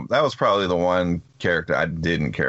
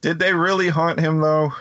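incredulous; 10 semitones higher than usual. Real, not acted. Incredulous question.

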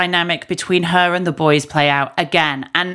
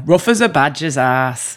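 northern English accent, lower; 4 semitones lower than usual. Shown as a demonstration. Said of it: "I love it when you do a northern accent".

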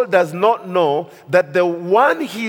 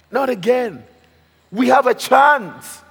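urgent plea; 7 semitones higher, 4 dB louder than usual. Retold or imitated. Men urge David to strike Saul.

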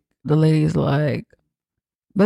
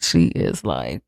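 ordinary talk, nothing marked

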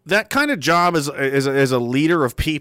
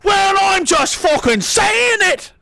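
much higher, louder, slow Scottish bellow; defiant shout; 5 dB louder, slower than usual. Pretend. Uncle bellowing back at the relatives, slow high Scottish shout. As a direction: roar it back stubbornly.

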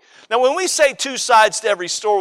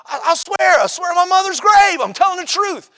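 high, insistent mock kid's vow; childlike oath; 9 semitones higher than usual. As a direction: swear it like a protesting kid. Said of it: "did you ever say it this way?".

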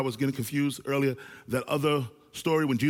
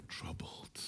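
whisper; 20 dB quieter than usual. Acted. Hushed key word.

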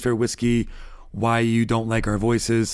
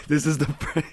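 laughing; 4 semitones higher than usual. Real, not acted. Forgot the word, cracking up.

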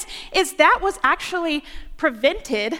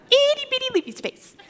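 much higher, small, laughing; tiny squeaky voice; 10 semitones higher, faster than usual. Acted. Preacher shrinking the kingdom to a seed, squeaky. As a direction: squeak it out like something teeny. Tiny contrast after grandeur.

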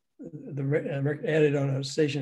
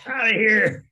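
higher, louder mock shout; retold dismissive shout; 7 semitones higher, 7 dB louder than usual. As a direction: shout it as if throwing someone out. Retold kicking out, called out loudly.

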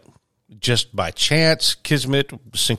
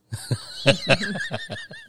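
long high laugh; amused laugh after a funny story; high delighted laugh; 12 semitones higher, 4 dB quieter than usual. Real, not acted. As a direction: laugh freely at the punchline.

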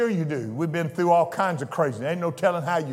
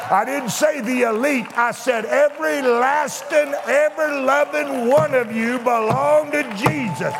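climactic preaching; 8 semitones higher than usual. Acted. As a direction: command them to stand up and declare.

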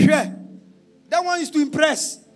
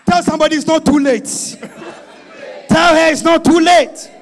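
fast loud urging; urgent preaching shout; 3 semitones higher, 8 dB louder than usual. Real, not acted. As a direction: press it on them.

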